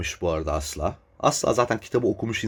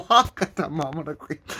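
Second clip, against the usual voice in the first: sarcastic disbelief; 6 semitones higher than usual. Retold or imitated. Sarcastic mock astonishment.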